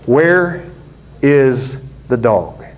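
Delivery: usual speaking voice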